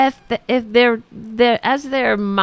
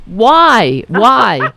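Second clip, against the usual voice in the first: louder, incredulous why; demanding it of the air, fed up; exasperated why; 7 dB louder than usual. Real, not acted. Outraged at Walker's joke.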